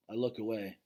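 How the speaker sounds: usual speaking voice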